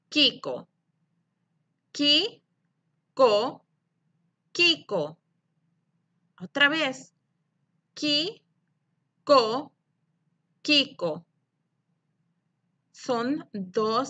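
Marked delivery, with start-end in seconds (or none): 1.9-3.6 "Ki-ko" as two firm, separate beats; loud "Ki", pause, then loud "ko"; split into syllables. Shown as a demonstration. Teacher models syllables for pupils, "Ki-ko" split in two. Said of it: "separar la palabra Kiko en sílabas". 7.9-9.7 "Ki-ko" with a long gap after "Ki"; rising "Ki", pause, then "ko"; split into syllables. Shown as a demonstration. Teacher repeats for pupils, "Ki-ko" split in two. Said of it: "separar la palabra Kiko en sílabas".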